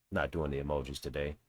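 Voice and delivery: usual speaking voice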